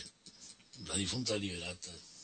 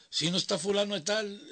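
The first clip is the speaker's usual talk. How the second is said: lightly voiced caller at the door; friend asking for compadre; 9 semitones higher, 3 dB louder than usual. Caller at the door asking the mother, lightly acted. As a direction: ask politely whether he is home. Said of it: "le decía su mamá".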